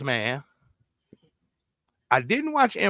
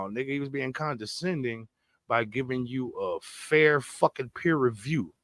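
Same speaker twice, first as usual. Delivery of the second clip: ordinary talk, nothing marked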